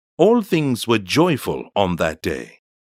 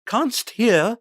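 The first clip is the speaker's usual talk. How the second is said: much higher voice of a worried crewman; anxious sailor; 14 semitones higher than usual. Acted. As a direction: ask it high, straining to hear. Anxious sailor speaks in dark.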